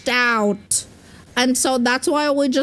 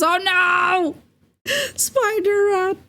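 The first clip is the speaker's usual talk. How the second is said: high alarmed yelp at the rat; a high mock-scared cry; surprised; 8 semitones higher than usual. Real, not acted. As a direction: squeal in fake alarm. Spots Spider Rat in game.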